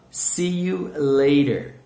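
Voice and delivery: loud, slow "See you later"; slow model phrase. Shown as a demonstration. Teacher models "See you later" for students, loud and slow. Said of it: "Então, vamos lá".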